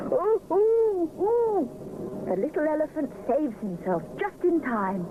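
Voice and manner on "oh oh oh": startled cries, high and loud; alarmed. A narrator's reading. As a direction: gasp it out as you wobble. Wobbling on Rupert's shoulders.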